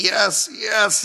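high, loud biased cheer; cheering commentator. Retold or imitated. Podcaster imitating the commentator cheering for the Russians, excited. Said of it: "he's just like".

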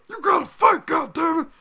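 high screaming imitation of a cornerman; mock corner yell. Host imitating corner screaming at his fighter.

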